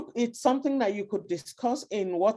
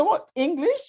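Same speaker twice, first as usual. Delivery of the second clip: imitated surprise, pitched high; surprised question; 9 semitones higher, 3 dB louder than usual. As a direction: act out a puzzled bystander's high-pitched query. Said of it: "And then you say".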